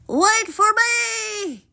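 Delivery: slow, very high frog holler; high, drawn-out plea; calling after. A narrator's reading. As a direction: stretch it out to someone far ahead. Frog calls after princess.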